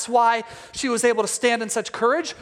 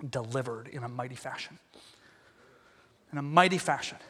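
very low, much softer; hushed solemnity; 10 semitones lower, 10 dB quieter than usual. Real, not acted. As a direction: drop to a reverent hush. Climax said hushed.

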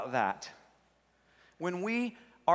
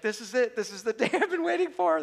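high, giddy acted thrill; giddy excitement; 7 semitones higher, 3 dB louder than usual. Admirer as the crush walks right towards them, giddy excitement. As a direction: play it thrilled, pitch climbing high.

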